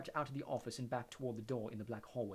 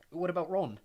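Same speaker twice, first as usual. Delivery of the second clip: fearful; 6 semitones higher, 9 dB louder than usual. A narrator's reading. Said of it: "said Harry fearfully".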